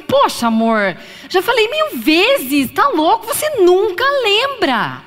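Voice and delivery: exasperated wife nagging her husband; critical complaint. Shown as a demonstration. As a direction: scold him for never remembering. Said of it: "O que que é uma crítica?".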